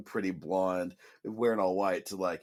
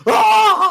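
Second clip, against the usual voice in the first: piercing high mock scream; anguished scream; 17 dB louder than usual. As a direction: scream out in hammed-up agony. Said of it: "his anguished scream".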